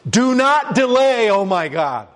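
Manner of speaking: high, slow desperate plea; pleading psalm. Acted. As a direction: cry out to God to hurry.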